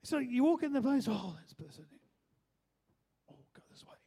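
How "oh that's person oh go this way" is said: low aside dropping to a whisper; hushed, acted dread of a meeting; mock furtive avoidance. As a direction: mutter it, then whisper as if dodging someone. Acts out avoiding a person.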